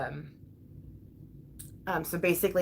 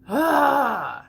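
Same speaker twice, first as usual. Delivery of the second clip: loud, high cathartic groan; venting yell; 7 semitones higher, 8 dB louder than usual. Real, not acted. Stressed mom releasing frustration to viewers, venting groan. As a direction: let all the frustration out in one cry. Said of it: "I needed that. That felt really good".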